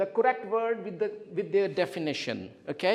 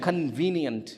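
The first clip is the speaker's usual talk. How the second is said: "Convenient" stressed on VE, then falling; stress on second syllable; 5 semitones lower, slower than usual. Shown as a demonstration. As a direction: model "Convenient" for repetition, second syllable prominent. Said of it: "Second syllable".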